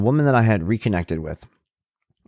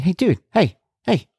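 calling for attention; 10 semitones higher than usual. Retold or imitated. Calling son's attention, acted out.

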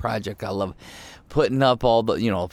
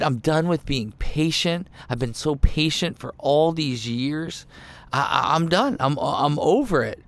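higher-pitched, patience-exhausted rant; acted exasperation, voice pitched up; fed-up Jesus; 6 semitones higher than usual. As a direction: vent like you've had enough of them all. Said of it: "is this what Jesus is saying here?".

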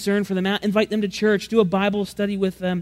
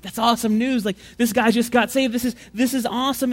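higher, gushing delight; mock excitement; 5 semitones higher than usual. Churchgoers reacting to the healing story, mock excited. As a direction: cheer like it's great news. Listeners cheering the news.

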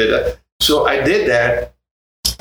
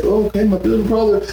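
quoting the others, higher; 10 semitones higher than usual. Retold or imitated. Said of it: "it's like".

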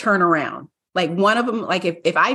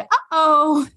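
higher, a light sing-song uh-oh; mimicking woman; 8 semitones higher than usual. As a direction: lightly imitate a stranger's mild alarm. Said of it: "the woman said".

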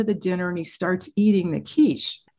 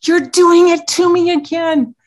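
much higher and louder mock teen wail; a hammed-up teen accusing his mom; imitating outraged teen; 10 semitones higher, 10 dB louder than usual. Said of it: "He goes".

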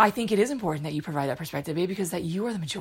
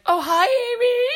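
much higher, louder gushing Mom voice with a laugh; mocking Mom; 5 dB louder than usual. Daughter mimics Mom greeting Amy, high, loud and laughing. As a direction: squeal it like Mom greeting her favorite. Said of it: "And then she's like".

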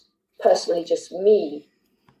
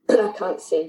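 ordinary talk, nothing marked

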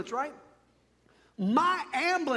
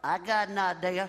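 Alabama horse farmer; 4 semitones lower than usual. Acted. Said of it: "a horse farmer from Alabama".

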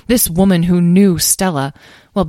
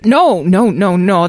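higher, louder flurry of denial; flustered, raised-voice protest; alarmed; 3 semitones higher, 6 dB louder, slower than usual. A narrator's reading. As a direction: hurry to deny it in alarm.

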